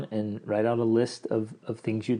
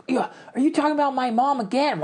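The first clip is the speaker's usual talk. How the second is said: much higher, squeaky put-on voice of a defensive spouse; mocking spouse voice; 13 semitones higher than usual. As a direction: mimic the touchy partner, pitched way up. Spouse mocked in a defensive retort.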